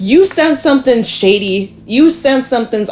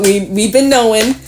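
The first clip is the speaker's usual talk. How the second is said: normal talking voice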